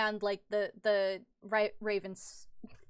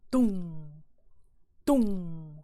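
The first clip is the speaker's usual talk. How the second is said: low-key, drawn-out drum boom; imitated drum beat; 3 semitones lower, 5 dB louder than usual. Game master sounds the skeleton's lone drum, slow imitated boom. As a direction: voice each slow drum thud.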